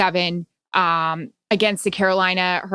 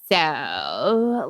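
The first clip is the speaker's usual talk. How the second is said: Kardashian twang; 3 semitones lower than usual. Retold or imitated. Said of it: "people in my life that are like".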